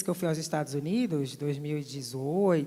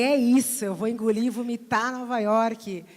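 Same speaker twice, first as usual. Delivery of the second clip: louder, fired-up vow; excited declaration; 4 semitones higher, 6 dB louder than usual. Retold or imitated. Speaker to audience, vowing to conquer New York, louder and fired up. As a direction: proclaim it like a battle cry. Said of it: "e eu falei".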